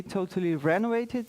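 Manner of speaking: ordinary delivery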